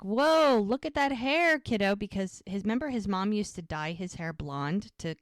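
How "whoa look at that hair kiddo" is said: high, louder retold "whoa"; playfully impressed, voicing a man greeting a kid; raised pitch, imitating an admiring greeting; impressed exclamation.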